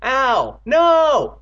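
high, loud pained yells; crying out. A narrator's reading. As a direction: yell in pain and horror. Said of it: "Both brothers cried out".

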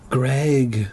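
ordinary talk, nothing marked

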